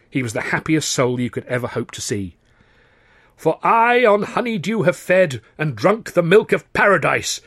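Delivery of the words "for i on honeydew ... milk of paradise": high, loud, rapturous recitation; seraphic bliss. Acted. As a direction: declaim the verse in beaming ecstasy. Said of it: "he beamed seraphically afterwards".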